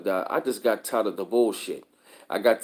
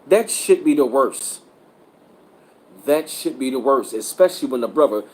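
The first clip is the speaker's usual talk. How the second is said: ordinary talk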